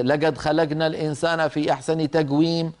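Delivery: hard g for every q in "لقد خلقنا" and "تقويم"; "تقويم" as tagwim, colloquial g; recited with gaf. Shown as a demonstration. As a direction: say "لقد خلقنا" as lagad khalagna. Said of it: "قراءة القرآن بهذه القاف".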